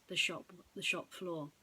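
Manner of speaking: usual speaking voice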